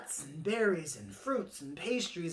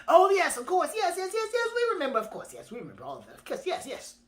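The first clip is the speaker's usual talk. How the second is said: high, rapid tumbling yeses; flustered agreeing birds; 11 semitones higher, 6 dB louder, faster than usual. Acted. Birds pretend to remember the tradition, hasty eager agreement. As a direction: chatter agreement over yourself, starting high and loud.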